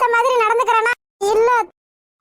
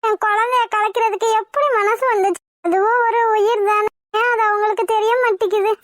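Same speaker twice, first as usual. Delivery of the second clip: normal talking voice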